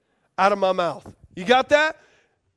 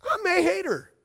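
doubtful realization; 6 semitones higher than usual. Retold or imitated. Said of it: "you woke up one day and said".

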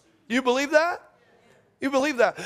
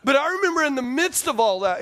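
ordinary talk, nothing marked

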